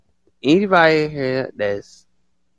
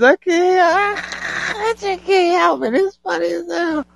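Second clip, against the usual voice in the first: high and loud, words squeezed out through laughter; can't stop laughing, fighting to talk; real giggling fit, voice pitched way up; 5 dB louder, slower than usual. Real, not acted. Helpless laughter at friend's line.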